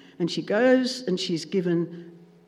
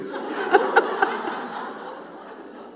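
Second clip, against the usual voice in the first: high breathy laugh; 12 semitones higher than usual. Real, not acted. Preacher delighting in the punchline to the congregation, high laugh. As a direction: let the laugh ride out.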